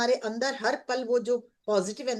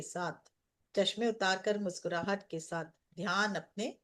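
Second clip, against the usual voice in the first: guided meditation; 2 semitones lower than usual. Acted. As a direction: ease the group into calm breathing.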